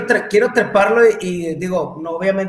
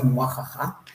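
soft mock evil laugh muajaja; a quiet gloating villain cackle; mustache twirling villain; 4 semitones lower, 6 dB quieter, slower than usual. Gloats he has them identified.

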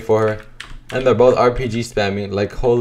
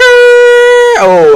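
surprised yelp; 11 dB louder than usual. Real, not acted. Sudden surprise.

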